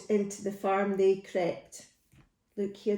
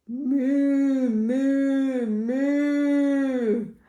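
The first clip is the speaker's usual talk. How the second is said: three long, raised moos; duck mooing; 4 semitones higher, 4 dB louder, much slower than usual. Acted. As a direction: moo like a cow, drawing each one out. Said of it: "the duck on the pond said".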